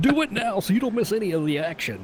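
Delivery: high, hyped infomercial pitch. Shown as a demonstration. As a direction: sell it like a late night ad. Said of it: "that's what it sounds like with them infomercials".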